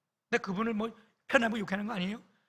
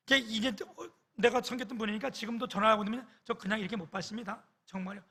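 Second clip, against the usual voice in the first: ordinary talk, nothing marked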